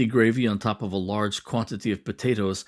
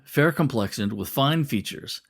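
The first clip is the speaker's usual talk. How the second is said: ordinary delivery, nothing marked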